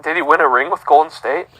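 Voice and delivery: ordinary delivery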